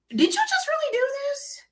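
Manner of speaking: high, incredulous; can't believe her eyes; stunned disbelief. Retold or imitated. Stunned at friend.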